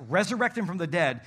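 normal talking voice

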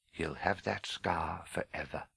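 low, soft, grave pronouncement; solemn old headmaster. Acted. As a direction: say it quietly and deep.

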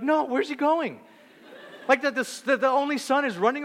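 alarmed reader. Retold or imitated. Reader alarmed at heir fleeing.

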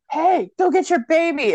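high-pitched imitation of calling out; mock calling out. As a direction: call out to a monster, hammed-up. Retold call to the creature.

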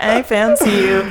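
drawn-out mock American accent; teasing take on an American singer's accent. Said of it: "in her little, like, American accent".

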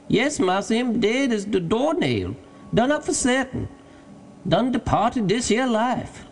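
high-pitched dialect voice, sure of it; Jupiter, emphatic servant. A narrator's reading. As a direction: call down certain confirmation from the tree. Jupiter confirms the limb is dead.

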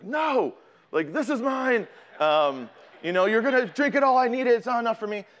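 put-on high, loud, whiny yell of a greedy teen; selfish panicked refusal. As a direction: snap it out like a kid guarding his water, voice cracking high. Teen self refusing brother water.

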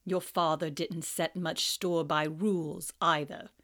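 low, cold, mocking teacher; sneering Snape. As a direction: drip with contempt.

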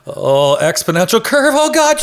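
hammed-up swoon climbing very high; comically faked rapture; mock ecstasy. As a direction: pretend to be overcome with pleasure, pitch rising. Mock arousal over spreadsheets.

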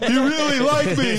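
very high, loud, gushing teary voice; mock tearful acceptance. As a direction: gush it like a weepy award winner. Quoting acceptance speech tearfully.

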